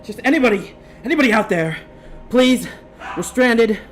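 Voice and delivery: high, loud, begging voice; desperate plea. Acted. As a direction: plead for rescue, panicked. GM voices a distress message.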